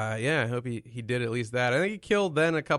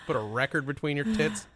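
ordinary delivery

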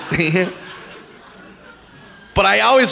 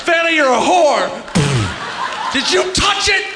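furious yell; much slower than usual. Retold or imitated. Losing contestant screaming at the show in hammed-up fury. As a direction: explode like a contestant who just went bankrupt. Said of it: "I'd be like".

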